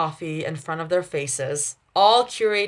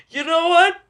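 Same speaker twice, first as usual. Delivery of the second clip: squealing through real laughter; giggling, voice high; laughing; 11 semitones higher, 6 dB louder than usual. Real, not acted. Reader laughing at own line.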